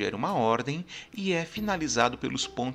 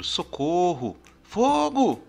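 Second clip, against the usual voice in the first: shouted Fogo for help, rising loud; example cries; 3 semitones higher, 5 dB louder, slower than usual. Shown as a demonstration. Teacher demonstrating a cry for help, mock alarm. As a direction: call out in alarm, fire!. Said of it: "Como, por exemplo".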